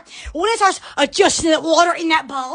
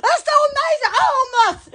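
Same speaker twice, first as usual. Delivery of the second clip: ordinary talk, nothing marked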